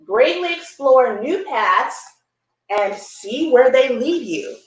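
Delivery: usual speaking voice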